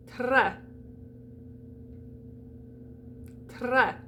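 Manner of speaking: très with rolled R and open E. Shown as a demonstration. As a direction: model très for repeating, S silent. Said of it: "roll that R".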